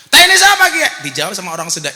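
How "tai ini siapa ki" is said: loud, high-pitched mock outrage; sudden acted yell; startled yell. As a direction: shout it out as the man who stepped in it. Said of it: "Langsung dia teriak".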